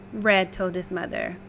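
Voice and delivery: plain reading voice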